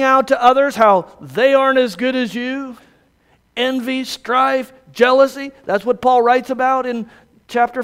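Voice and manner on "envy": ordinary talk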